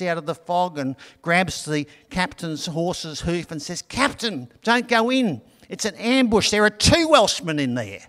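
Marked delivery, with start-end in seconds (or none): 3.8-8.0 high, urgent voice of a battered soldier; a lightly voiced desperate soldier gasping a warning; wounded soldier. Wounded soldier grabs the captain's hoof to warn him, high and urgent.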